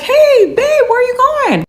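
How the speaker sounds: high-pitched imitation of a husband calling out; imitated husband hailing his wife, voice way up; calling out to partner. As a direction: sing out a mock clueless "where to?". Husband calls out to departing wife.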